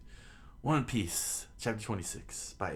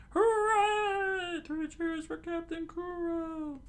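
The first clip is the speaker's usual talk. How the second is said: very high falsetto cheer; loud squeaky hooray, then drawn out slowly; crew cheering; 4 dB louder, much slower than usual. Acted. Pirate crew cheers on its captain, high cheering. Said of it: "cheered on".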